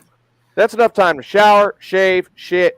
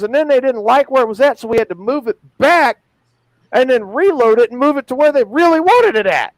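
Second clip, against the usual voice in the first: exasperated bunkmate; 6 semitones higher, 4 dB louder than usual. Retold or imitated. Bunkmate quoted complaining.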